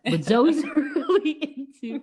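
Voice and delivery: breaking into laughter mid-sentence; laughing. Real, not acted. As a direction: giggle while you say it. Laughing at the name mix-up.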